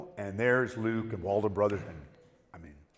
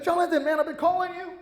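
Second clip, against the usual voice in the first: high, eager calling out; imitating the friend calling him from afar, high; 4 dB louder than usual. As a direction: call across the street, high and eager. Luke calling out.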